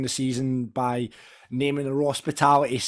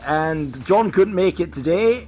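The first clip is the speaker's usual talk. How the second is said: put-on, unsure English accent, raised pitch; mock English accent; 3 semitones higher, 4 dB louder than usual. Host posing as absent guest Tom Lang, shaky-guess English accent. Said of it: "I don't even know if this is his accent".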